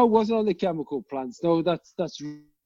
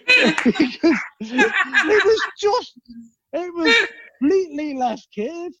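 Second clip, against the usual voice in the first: laughing high through the words; giggling while explaining; 11 semitones higher, 4 dB louder, slower than usual. Real, not acted.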